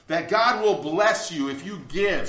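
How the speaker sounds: ordinary talk, nothing marked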